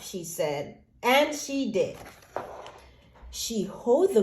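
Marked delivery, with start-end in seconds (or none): none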